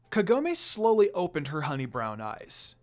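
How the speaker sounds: plain reading voice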